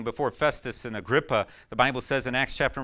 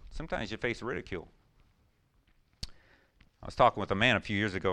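normal talking voice

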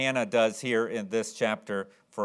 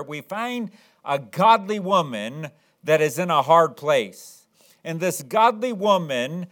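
loud, raised-pitch pulpit delivery; slow, weighty, declaiming emphasis; emphatic preaching; 4 semitones higher, 6 dB louder than usual. Real, not acted. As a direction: preach it forcefully, leaning hard on key words. Preaches with rising force.

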